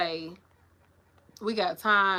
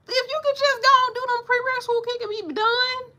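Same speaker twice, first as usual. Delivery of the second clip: worked-up, squealing exasperation; exasperated pleading; 4 dB louder than usual. Real, not acted. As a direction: stretch it out high, begging her to get it. Exasperated pep talk to her niece.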